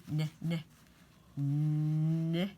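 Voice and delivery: hummed n sounds; teacher sounding out "n"; phonics sound. Shown as a demonstration. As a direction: say "n" as a hum. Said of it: "and we need the n".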